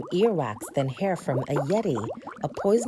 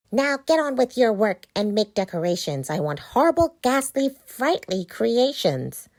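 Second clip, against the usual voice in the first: witch voice; 5 semitones higher, 5 dB louder than usual. Acted. Witch orders pumpkins.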